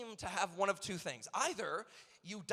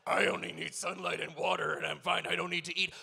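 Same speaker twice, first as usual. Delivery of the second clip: Piccolo quote; 3 semitones lower, 4 dB louder than usual. Retold or imitated. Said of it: "he says".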